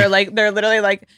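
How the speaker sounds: ordinary talk, nothing marked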